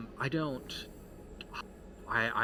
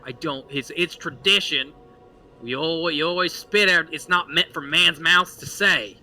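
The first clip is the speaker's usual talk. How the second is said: loud, raised, insistent voice; old townsman defending tradition; 6 semitones higher, 9 dB louder than usual. Acted. Randall defending his spitting in church, loud and insistent. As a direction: argue it loudly as a stubborn old believer.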